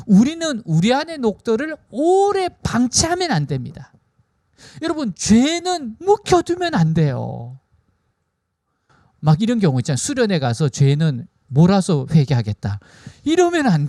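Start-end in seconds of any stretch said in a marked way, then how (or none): none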